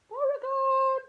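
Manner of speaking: high loud call to the dog; calling the dog across the house. Real, not acted. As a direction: shout the dog's name.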